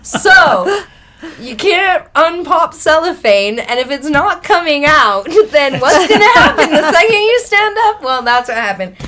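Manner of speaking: loud, high-pitched explanation breaking into laughter; real laughing. Real, not acted. Speaker explaining why the trick failed, loud helpless laughing. Laughing over embarrassing drug-test mishap.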